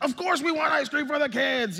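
gleeful exclamation. Retold or imitated. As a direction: burst out like a kid offered treats. Dad's eager reply to waitress.

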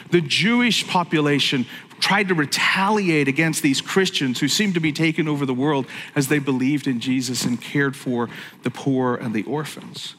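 normal talking voice

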